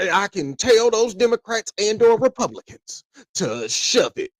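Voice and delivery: high, folksy campaign-speech voice; politician impression. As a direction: pitch it up like a smooth-talking candidate. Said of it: "The regular guy".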